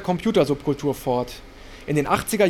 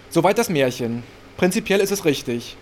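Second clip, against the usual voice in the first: usual speaking voice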